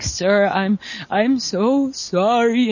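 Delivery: slow, meek, drawn-out "sorry"; a lightly voiced mortified apology; sheepish apology. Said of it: "my tail's tucked between my legs".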